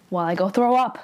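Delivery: usual speaking voice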